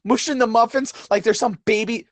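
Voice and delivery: ordinary delivery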